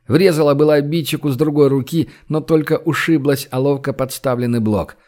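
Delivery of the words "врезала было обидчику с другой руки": plain reading voice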